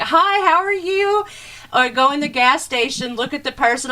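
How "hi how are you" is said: high, slow, sing-song friendly hello; chirpy Southern greeting. Retold or imitated. Friendly Southerner greeting a drive-thru worker, sing-song and high. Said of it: "I'm like".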